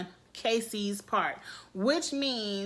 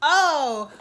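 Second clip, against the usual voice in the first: surprised oh; 5 semitones higher, 9 dB louder than usual. Real, not acted. Reacting to Bailey's line.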